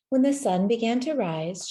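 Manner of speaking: usual speaking voice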